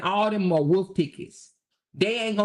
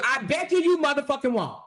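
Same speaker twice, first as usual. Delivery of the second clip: higher taunting dare; mocking taunt; 7 semitones higher than usual. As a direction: throw the dare back at them, mocking.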